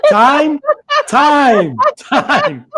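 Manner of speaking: high, loud, laughing calls; calling time. Real, not acted. Host calls out that the three minutes are up, laughing shout. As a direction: call time over the laughter.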